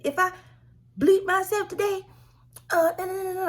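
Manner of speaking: high, wailing imitation of a crying mother; mock crying mother. Imitating her mother's crying accusations, high and wailing. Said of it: "she spazzing".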